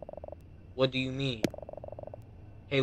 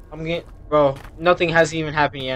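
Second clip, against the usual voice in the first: ordinary talk, nothing marked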